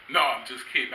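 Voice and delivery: ordinary talk, nothing marked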